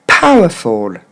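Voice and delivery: "Powerful" as PAH-wuh-fol with explosive P; correct English pronunciation. Shown as a demonstration. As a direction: stress "Pow", drop to a low fol. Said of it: "maintenant pour le mot en entier".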